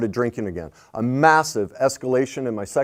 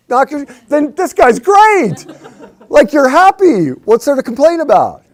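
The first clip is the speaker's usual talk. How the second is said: high, loud, laughing mockery; mock delight; 10 dB louder than usual. Mocking someone happily in love with a killer, laughing loudly. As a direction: laugh through a sarcastic cheer.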